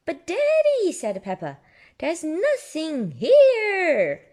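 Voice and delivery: high, loud, slow puzzled pig; Peppa voice. Acted. Peppa telling Daddy the plot is empty, high loud. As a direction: protest like Peppa.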